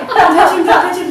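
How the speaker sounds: high, loud echo of a warning; excited protest. Retold or imitated. Fan echoes Bill's warning, excited protest. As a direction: repeat it loudly, bursting to hug. Echoing warning while starstruck.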